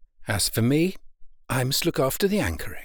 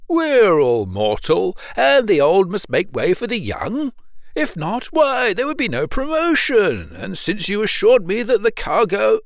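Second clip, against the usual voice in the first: rising higher as the consolation grows; increasingly comforted; 7 semitones higher, 4 dB louder than usual. A narrator's reading. Owner consoles himself before the young sailor, increasingly comforted. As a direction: reason yourself into good cheer, voice lifting. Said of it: "appeared more comforted at every moment".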